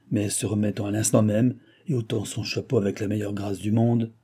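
ordinary delivery, nothing marked

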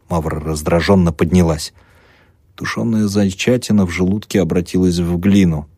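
ordinary delivery

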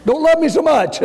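put-on high-pitched whiny kid complaint; mock child protest. Preacher mimics himself as a boy, high-pitched and whiny. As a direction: whine like a boy dodging a spanking.

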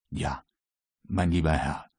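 low, soft opening; confiding start. A narrator's reading. Croxton starts explaining to his master, low and soft. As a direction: begin the tale low and confidentially.

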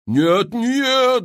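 high loud protesting cry; wailing no. A narrator's reading. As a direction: howl from the back rows. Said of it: "завопили". (вопль «нет, нет» высоким голосом)

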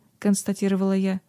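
plain reading voice

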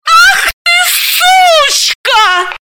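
very high, loud, furious shriek; furious scream. Acted. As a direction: shriek it at a betrayer. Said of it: "завопила Галина". (яростный визг)

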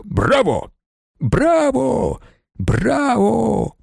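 high, drawn-out bravos; cheering. A narrator's reading. Racing crowd applauding the winner, excited cheers.

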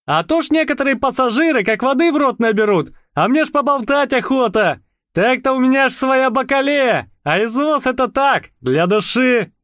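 high, fast folksy chatter; chatty cab driver. A narrator's reading. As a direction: rattle on like a talkative cabbie. Chatty cab driver over engine.

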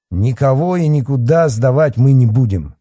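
loud, high emphatic veto; firm refusal. A narrator's reading. Boss overrules the policeman's demand, firm refusal. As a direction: cut the argument off. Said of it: "отрезал Михайлов".

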